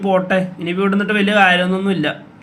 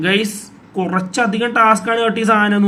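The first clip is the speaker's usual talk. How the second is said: usual speaking voice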